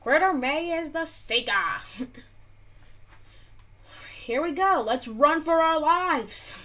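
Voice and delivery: loud, high panicky call; excited alarm. Real, not acted. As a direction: shout to run. Seeker announced, starts running.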